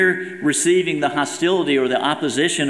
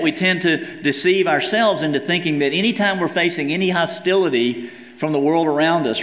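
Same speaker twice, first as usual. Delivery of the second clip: ordinary delivery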